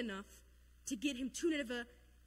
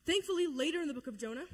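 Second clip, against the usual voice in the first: ordinary talk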